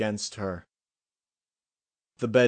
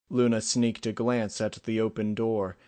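ordinary delivery

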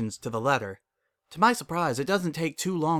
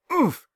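winded oof; 8 dB louder than usual. Acted. Kid hugs him, he falls.

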